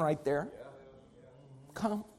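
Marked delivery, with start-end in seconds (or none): 1.7-2.0 barely voiced, gentle "Come"; a near-silent, tender "Come"; soft invitation. Shown as a demonstration. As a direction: breathe the word "Come" softly. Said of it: "hardest word in all the gospel".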